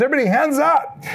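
high-pitched rallying call; rally a group of kids, playfully re-enacted.

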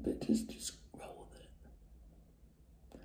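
slow, unvoiced whispered aside. Real, not acted. Vlogger brushing off her accent to viewers, slow whisper. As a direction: murmur it off to the side.

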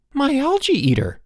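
very high, distressed cry; exclaimed. A narrator's reading. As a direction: cry out in dismay. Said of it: "Elmer exclaimed".

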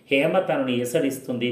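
ordinary delivery, nothing marked

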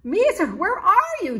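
high, loud shout. A narrator's reading. Charlie shouting to find the hidden kitten, loud shout. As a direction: shout into the darkness. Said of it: "Charlie shouted".

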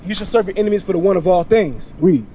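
ordinary talk, nothing marked

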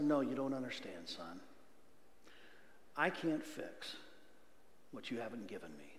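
soft, fading voice of God; gentle God voice. Retold or imitated. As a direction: speak as a patient father to his son. Preacher voices God's reply.

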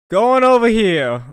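loud, high, slow call. Real, not acted. Player calling out the move mid-game, loud and drawn out.

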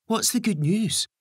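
put-on high, impatient boy's voice. A narrator's reading. Ben demanding the good news from Gary, high and impatient. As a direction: push eagerly for the news, pitched high.

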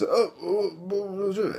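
high, startled stammer of a caught intruder. Retold or imitated. Caught intruder stammering to the homeowner, high and startled. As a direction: squeak out the oh like a man caught in the act. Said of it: "And he says".

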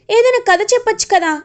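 put-on high, fast childlike pleading; little girl begging. Little girl begging her mother for a story, childlike. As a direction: beg sweetly like a small child.

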